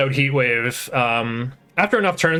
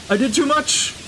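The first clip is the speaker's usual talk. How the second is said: surprised; 13 semitones higher than usual. Real, not acted. Sudden setback in the game.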